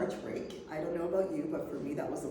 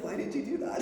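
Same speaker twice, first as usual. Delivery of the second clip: laughing; 8 semitones higher than usual. Retold or imitated. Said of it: "And I said".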